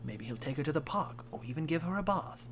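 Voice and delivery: ordinary talk, nothing marked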